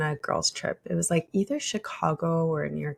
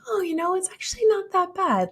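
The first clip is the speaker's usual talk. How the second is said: pleasantly surprised; 13 semitones higher than usual. Retold or imitated. Pleasantly surprised by dating site.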